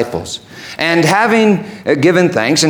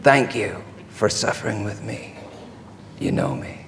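low, soft, intimate voice; tender reply; 5 semitones lower, 6 dB quieter than usual. Acted. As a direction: drop low and quiet, with long pauses. Jesus gently answers Peter.

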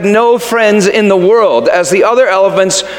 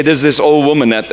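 ordinary talk, nothing marked

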